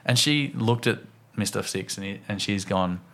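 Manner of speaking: ordinary talk, nothing marked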